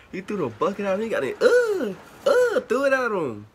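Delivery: incredulous high-pitched outburst; stunned disbelief. Real, not acted. Keeps reacting to the bucket.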